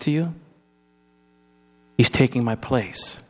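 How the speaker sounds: soft, low and gentle; softly reassuring a mother at the cross; tender reassurance. Acted. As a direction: comfort his grieving mother quietly. Jesus voiced tenderly at cross.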